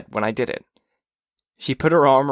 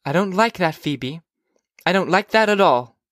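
higher-pitched mother's reproof; disapproving mother; 6 semitones higher than usual. Acted. Mother to Phoebe about smoking, disapproving and higher-pitched. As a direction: gently scold a child. Mother scolds her daughter.

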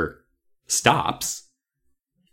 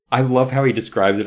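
ordinary talk, nothing marked

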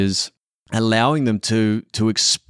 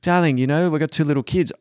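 higher, patient mock wife voice; wife calmly reminding him they have two little kids, higher; wife's reply; 6 semitones higher, faster than usual. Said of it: "my chosen one's like".

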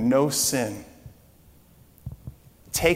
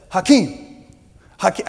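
dad's voice; 6 semitones higher, 7 dB louder than usual. Retold or imitated. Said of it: "I can't do my dad's voice".

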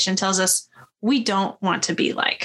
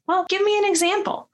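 lightly voiced sidekick, pitched up high; a hint of a character voice, much higher; high-pitched retold request, lightly acted; Donkey; 11 semitones higher, faster than usual. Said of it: "So Donkey says".

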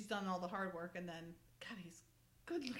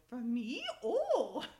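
a breathless, thrilled countess, slow on wunderbar; swooning countess; 6 dB louder, slower than usual. Retold or imitated. Friend retelling the Countess meeting a handsome man, gushing mock-German delight. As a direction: gush it like a smitten countess.

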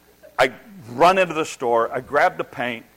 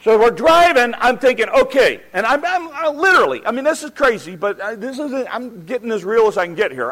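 very high, loud, worked-up account; frantic storytelling; 10 semitones higher, 7 dB louder than usual. Retold or imitated. Driver confessing to listeners how desperate it got, frantic. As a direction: pitch it up into comic desperation.

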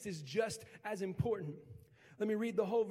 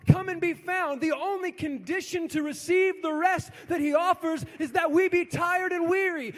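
rising, louder preacher's call; preaching at full force; 8 semitones higher, 8 dB louder than usual. Acted. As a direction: build each line higher and louder. Preaching crescendo.

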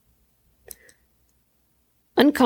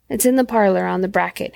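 ordinary delivery

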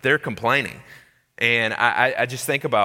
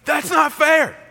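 put-on much higher, louder childish whine on not; whiny kid; 8 dB louder than usual. Buddy's son protesting, high loud childish whine. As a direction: sulk like a wronged little kid. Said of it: "stage where everything that happens".

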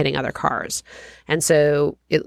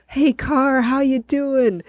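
bright, much higher cheery hello to the car; upbeat greeting; 9 semitones higher, slower than usual. Retold or imitated. Getting in the car, greeting it cheerfully, much higher and upbeat. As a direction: chirp it at the car like a friend. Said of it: "such an upbeat".

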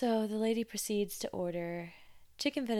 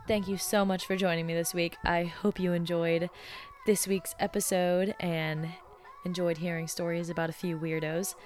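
louder, upbeat sign-off over music; podcast outro read; 6 dB louder than usual. Acted. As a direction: wrap up the show like a host thanking listeners.

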